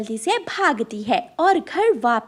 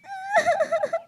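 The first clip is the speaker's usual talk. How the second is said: high sneaky crow cackle; sly cackle. Acted. Crow gloating after fooling kids, cackle. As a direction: cackle to yourself.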